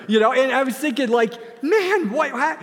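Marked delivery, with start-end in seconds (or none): 1.6-2.6 baffled. Retold or imitated. Recalled baffled thought.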